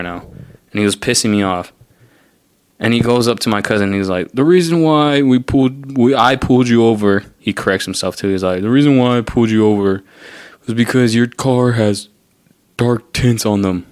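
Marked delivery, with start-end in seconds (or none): 4.3-7.2 a lightly voiced stiff cop; mock cop voice. Retelling the traffic stop to viewers, mock cop voice. Imitating the cop's explanation. 8.6-13.8 mock cop voice. The cop restating why he pulled them over, mild mock officer voice. Cop's corrected explanation.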